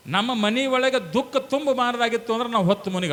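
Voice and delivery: high, driving exhortation; full-force preaching. Real, not acted. Preacher urges wives to greet husbands well, in full-force preaching.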